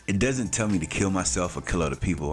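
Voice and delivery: normal talking voice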